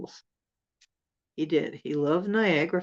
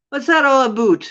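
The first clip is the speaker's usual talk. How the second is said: ordinary talk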